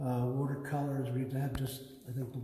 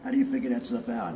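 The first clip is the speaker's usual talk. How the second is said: higher, wondering father's voice; amazed dad; 9 semitones higher, 3 dB louder than usual. Retold or imitated. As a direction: marvel at it like a proud dad.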